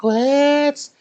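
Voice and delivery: high hyped "let's go"; mock hype yell; hyped cheer. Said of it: "I was like".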